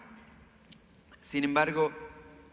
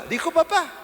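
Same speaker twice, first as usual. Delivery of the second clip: much higher, loud delighted gasp of a parent; excited parent; 10 dB louder than usual. Retold or imitated. Parent hearing baby's first word, thrilled high squeal.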